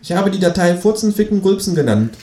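high cheeky kangaroo. Acted. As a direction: answer like the cocky kangaroo.

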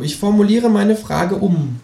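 high, slow, patient kangaroo. Acted. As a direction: rephrase it slowly as the smug kangaroo. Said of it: "sagt das Känguru". (hohe, langsame, geduldige Känguru-Stimme)

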